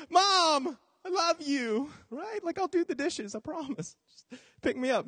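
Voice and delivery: put-on high begging little kid; pleading child. Abandoned kid begging mom to stop, high pleading. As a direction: beg your mom to come back. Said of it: "you're like".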